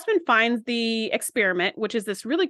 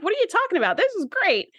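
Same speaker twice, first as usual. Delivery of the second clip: high-pitched, imitated disbelief; incredulous retort; 9 semitones higher than usual. Pressman answering Riker's regret over the experiment, incredulous retort. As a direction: protest in a much higher pitch, baffled.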